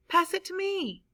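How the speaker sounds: high mock yell across the field. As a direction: call for the ball, pitched well up. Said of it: "and yelled".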